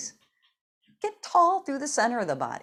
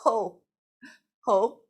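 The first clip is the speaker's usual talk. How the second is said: a bit smaller ho exhales; 6 dB louder than usual. Shown as a demonstration. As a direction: rein the ho in a little. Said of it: "Make it smaller".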